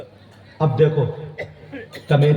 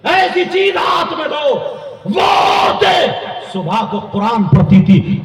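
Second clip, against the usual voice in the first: high shouted sermon phrases; impassioned preacher crying out to a crowd; fiery preaching; 8 semitones higher, 3 dB louder than usual. Real, not acted. Preaches at full force.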